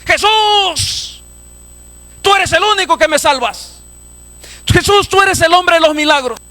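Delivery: high-pitched beseeching cry; preacher acting a blind man's plea. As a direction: call out to be saved. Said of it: "Y clamó más".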